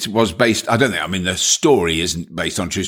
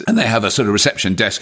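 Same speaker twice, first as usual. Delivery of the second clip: ordinary talk, nothing marked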